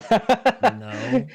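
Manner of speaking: loud laughing burst. Real, not acted. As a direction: blurt it out laughing. Laughing reaction to joke.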